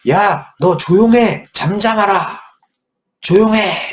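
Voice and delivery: high, loud, slow telling-off; scolding. Acted. Crowd scolding the shouting beggar, loud and stern. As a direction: shush him down sternly. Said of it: "막 혼냈대요".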